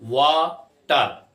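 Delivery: वाटर split in two slow, separated syllables; drawn-out वा, then टर. Shown as a demonstration. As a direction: say वाटर slowly with a break between its syllables. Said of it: "syllable से pronounce कराते हैं".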